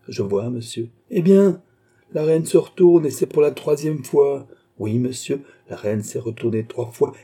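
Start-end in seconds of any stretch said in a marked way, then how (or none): 1.1-4.5 raised pitch, loud and pressing; excited insistence. Acted. Father showing his son the queen turning, excited. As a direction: drive the point home excitedly.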